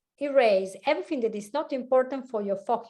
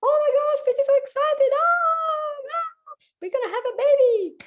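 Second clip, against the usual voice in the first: much higher and louder, bursting with excitement; excited father-to-be; 7 dB louder than usual. Retold or imitated. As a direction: squeal the news like an overjoyed new dad. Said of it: "And he goes".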